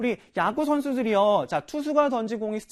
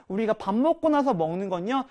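ordinary talk

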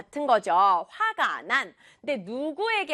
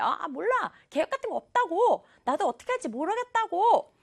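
high, fast mock exasperation; exasperated outburst; 7 semitones higher, faster than usual. Teacher voices the boy with no plans, exasperated outburst. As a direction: blurt it out higher and quicker, fed up. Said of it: "답답하니까 이렇게 소리를 질러버렸어요".

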